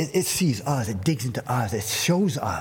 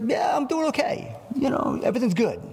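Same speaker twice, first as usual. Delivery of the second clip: much higher, falsely bright reply; put-on cheerful; 10 semitones higher than usual. Pastor mimicking a hurting person saying they're fine. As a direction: cover the pain with cheer. Faking being okay.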